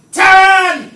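sudden loud shout of a name; yell. Shown as a demonstration. Husband yelling at his wife, a full loud shout. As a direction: bellow her name across the house. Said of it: "I yell at my wife now and again".

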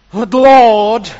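loud alarmed "But Lord"; protesting Gideon. Acted. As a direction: burst out with "Lord". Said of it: "is better there".

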